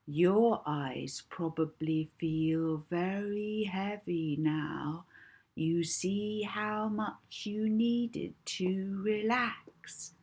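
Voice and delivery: drawn-out hypnotist's calm; soothing hypnotist voice. Acted. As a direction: lull her under, slowly. Molly hypnotising Edna, soothing.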